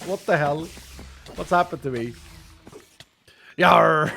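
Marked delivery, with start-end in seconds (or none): 3.6-4.1 loud hammed-up pirate "Yar!"; pirate yell. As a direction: bark it like a stage pirate. Pirate cry after accent joke.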